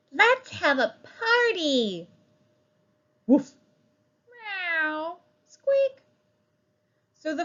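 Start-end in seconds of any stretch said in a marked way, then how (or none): 0.1-2.1 high, eager party call; a child thrilled at the idea; excited. Acted. Excited suggestion. 3.3-3.5 happy woof. Acted. Dog cheers. 4.3-5.2 high, sung-out meow; cat joining in, higher; cheerful meow. Acted. 5.4-6.0 very high, loud mouse squeak. Acted. Rat cheers.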